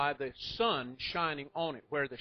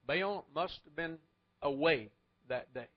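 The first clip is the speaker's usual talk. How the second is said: ordinary talk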